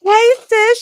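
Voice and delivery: high-pitched loud hail. Retold or imitated. Neighbor hailing the woman walking past, calling out. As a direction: call it out high to catch her.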